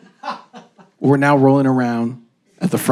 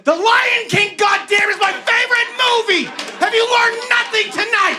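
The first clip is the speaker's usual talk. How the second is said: much higher, yelling in mock outrage; furious shout. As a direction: scream it at the crowd as if betrayed. Angry outburst at the audience.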